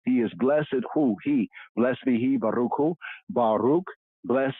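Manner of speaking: ordinary talk, nothing marked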